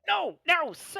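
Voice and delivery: high, fast, fussy gnome chef voice; squeaky harried little chef. Acted. A head chef to kitchen arrivals, high and fussy. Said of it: "The gnome immediately is like".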